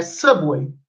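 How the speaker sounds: "subway" in a lightly American accent. Shown as a demonstration. Teacher showing American word to students. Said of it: "inglês americano, é".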